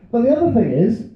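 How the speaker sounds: ordinary talk, nothing marked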